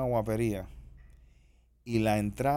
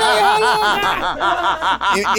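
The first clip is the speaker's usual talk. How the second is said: high, loud jeer of onlookers; mock pointing shout; 10 dB louder than usual. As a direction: cry out as a gawking crowd, hammed up. Acting bystanders pointing at crazy old lady. (grito agudo de gente señalando, exagerado)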